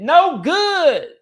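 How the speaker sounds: ordinary talk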